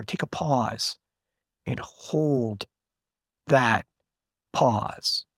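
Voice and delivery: a long, held break before "and hold", "that" and "pause"; deliberate dramatic pauses. Shown as a demonstration. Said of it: "put pauses that you do shit like I just".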